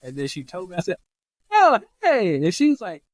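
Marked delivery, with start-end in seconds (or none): 1.5-2.3 high, loud delighted hello; excited greeting. Retold or imitated. Patient to surprise visitor, excited greeting. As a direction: burst out with a big happy hello.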